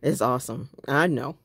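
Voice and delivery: ordinary talk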